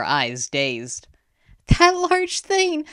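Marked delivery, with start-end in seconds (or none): 1.7-2.9 very high, strained exclamation; distressed. A narrator's reading. Dazed girl revealing her vision, distressed. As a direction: gasp out what was seen.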